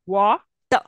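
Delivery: Wa-ter broken in two, the final r dropped; split into syllables. Shown as a demonstration. Teacher showing the class a syllable break. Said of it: "I'm not saying r sound there".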